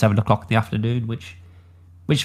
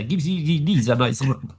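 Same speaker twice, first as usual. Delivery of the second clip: put-on Russian accent; 3 semitones higher than usual. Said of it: "As you sounded Russian just there".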